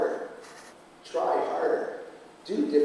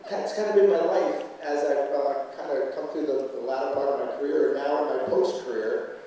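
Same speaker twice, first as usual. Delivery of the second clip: normal talking voice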